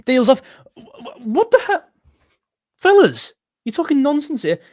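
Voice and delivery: high, loud disbelieving outcry; shocked outburst. Real, not acted. Fan reading shock transfer news to his stream, a high loud disbelieving outcry. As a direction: yelp it out in total disbelief.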